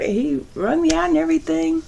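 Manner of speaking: ordinary talk, nothing marked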